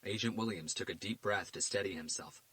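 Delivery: plain reading voice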